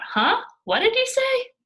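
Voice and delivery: high, loud teasing yell; mocking blurt. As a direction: blurt it out to provoke laughs.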